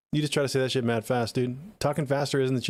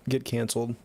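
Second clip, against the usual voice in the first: normal talking voice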